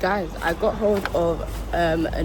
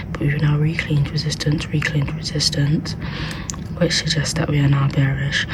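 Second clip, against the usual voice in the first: hushed library whisper; 8 dB louder than usual. Real, not acted. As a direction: whisper so the library can't hear. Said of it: "I have to whisper because obviously I'm in the library".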